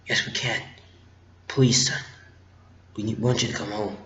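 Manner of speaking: low, hushed acted plea; soft pleading. Fan voicing Han Solo asking his son home, soft pleading. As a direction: gently urge someone in a soft voice.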